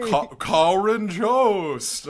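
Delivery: loud, high, elongated name call; spoof cast announcer. Pretend.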